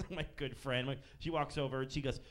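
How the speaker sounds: normal talking voice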